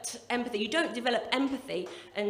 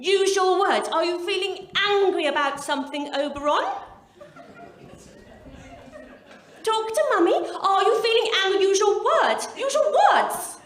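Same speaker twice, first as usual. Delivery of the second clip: higher, louder, sing-song mock mum coaxing a screaming toddler; posh gentle-parenting mum; 6 semitones higher, 8 dB louder than usual. Posh mum in the post office queue coaxing her screaming boy, hammed-up sing-song. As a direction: coo at the child in a hammed-up posh mummy voice.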